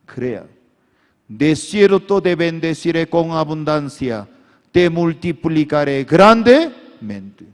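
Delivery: high, loud fervent preaching; preaching at full force. Acted. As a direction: shout the message to the crowd. (predicación a gritos)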